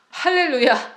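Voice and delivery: bursts out laughing into a loud, high hallelujah. Real, not acted. Preacher laughing to the congregation, loud joyful hallelujah. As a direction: cheer it with a laugh.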